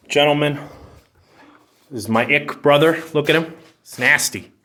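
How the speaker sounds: loud, high mocking announcement; loud teasing. Real, not acted. Presenting her brother to viewers, loud jeering. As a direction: show him off to the crowd, jeering.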